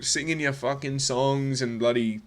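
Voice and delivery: normal talking voice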